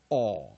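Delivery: 哦 drawn out as a steady "aw"; single vowel aw. Shown as a demonstration. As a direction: hold 哦 open with no oo glide. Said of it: "一个念哦". (哦 拉长念成单一元音)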